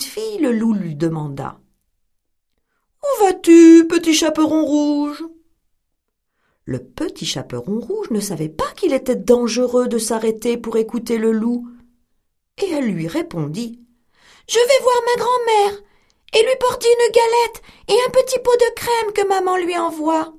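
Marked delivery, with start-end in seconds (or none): 3.0-5.2 put-on louder, slightly higher wolf hailing the girl; a wolf's bold greeting in the woods; sly wolf calling. 14.5-20.3 put-on much higher, sweet child's voice; little girl voice. Girl's voice in story.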